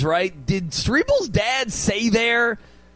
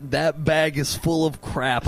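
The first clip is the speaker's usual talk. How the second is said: slow, low slogan read; 7 semitones lower, 3 dB quieter, slower than usual. Acted. Echoes line as t-shirt slogan.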